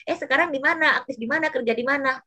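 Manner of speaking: high, prying mock question; woman mocking the ladies' gossip, high and prying; nosy gossiping moms. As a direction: mimic the chatty aunties asking where you work now. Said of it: "mama, ibu-ibu kalau udah ngumpul".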